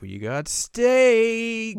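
high, slow, drawn-out mom voice; sing-song mom. Retold or imitated. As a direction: stretch it out like a cheerful mom announcing dinner. Voicing Kagome's mom.

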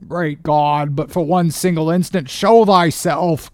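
high, loud, desperate appeal; crying out. Acted. Starbuck appealing to God, crying out. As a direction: cry out in protest. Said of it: "cried Starbuck".

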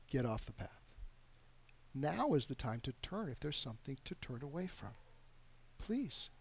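quiet, earnest pleading; soft pastoral plea. Real, not acted. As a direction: plead with them gently. Pleading softly in sermon.

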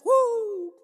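high whooping cry of praise; happy holy whoop; joyful woo. Retold or imitated. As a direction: break off the song with a woo. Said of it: "she would just start singing".

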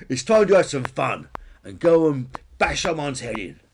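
loud, eager dinosaur voice; bonehead dinosaur Peter. A narrator's reading. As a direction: pipe up loudly, keen for mischief. Peter eager for some bashing.

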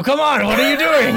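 high, loud pirate bluster; hamming up a pirate captain. Acted. Host opening the show in a pirate voice. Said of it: "this stupid voice".

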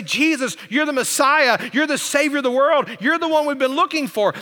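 high, impassioned acclamation; crying out. Retold or imitated. Blind men acclaim Jesus as Savior, crying out. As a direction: proclaim it high, phrase after phrase. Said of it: "they're crying out for help".

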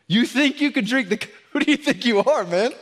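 high, loud mocking laugh through the words; hammed-up sarcastic put-down. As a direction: scoff at them with a laugh. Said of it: "said it with a laugh".

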